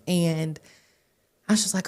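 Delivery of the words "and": ordinary delivery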